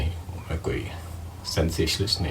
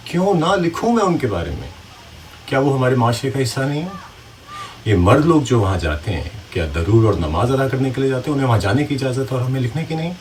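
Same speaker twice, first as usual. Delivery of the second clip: raised, pressing rhetorical questions; indignant retort; 5 semitones higher, 4 dB louder than usual. Acted. Writer answering critics of his subject, indignant. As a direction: throw the questions back in acted indignation. Challenged on writing about prostitutes.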